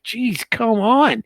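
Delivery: slow, high, exasperated groan of a line; impatient. Retold or imitated. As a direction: drag it out, fed up with the long speech. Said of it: "I'm like".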